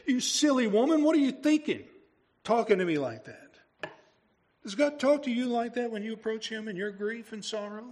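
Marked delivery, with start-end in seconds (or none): 0.1-3.4 a hammed-up scolding, pitched higher; mock harsh rebuke. Jesus scolding grieving Martha, mock harsh rebuke. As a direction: scold her as a stern master would, half in jest. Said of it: "Does he say".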